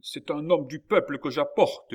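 high, loud, impassioned retort; fiery. A narrator's reading. Old man pleading for his child to the surgeon, fiery. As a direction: argue back with fire. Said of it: "dit le vieillard avec feu".

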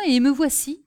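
plain reading voice

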